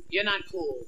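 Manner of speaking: usual speaking voice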